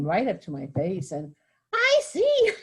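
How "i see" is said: put-on much higher, louder little old lady with heavy British accent; shrill-free high British granny scolding a tourist; fussy old British guard. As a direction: squeal it like a riled-up tiny old guard. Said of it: "in a really heavy British accent".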